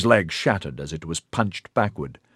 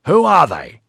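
sharp shout, higher and louder; desperate shouted demand; yelled question; 8 semitones higher, 8 dB louder than usual. A narrator's reading. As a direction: yell it at her across the lawn. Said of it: "he yelled at Corilin".

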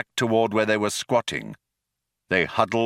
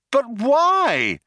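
much higher, louder demand; 4 dB louder than usual. A narrator's reading. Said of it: "demanded Trillian".

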